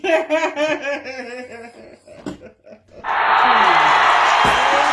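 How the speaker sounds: big loud burst of laughter; loud delighted laugh. Real, not acted. Delighted by the food, a loud laugh. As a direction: let out a loud happy laugh.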